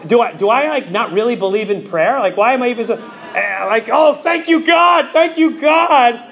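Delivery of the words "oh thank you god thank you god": shout of thanks. Retold or imitated. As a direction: burst out thanking God.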